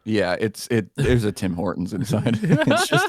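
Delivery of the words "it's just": laughing. Real, not acted. Laughing over Tim Hortons joke.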